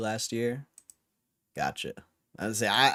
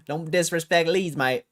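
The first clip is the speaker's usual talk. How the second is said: high, laughing attempt at an English accent; mock English accent; 6 semitones higher than usual. Streamer teasing a Leeds fan in a mock English accent. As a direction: ham up a British football lad. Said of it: "can't do my English accent anymore".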